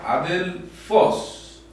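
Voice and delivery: adelfos slow, with FOS pitched high; stressed last syllable. Shown as a demonstration. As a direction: stretch adelfos and lift the final -fos. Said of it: "este acento va acá".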